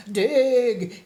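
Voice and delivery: loud shouted order. Acted. The bear shouting at the moles to dig, loud call. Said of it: "he shouted to the moles".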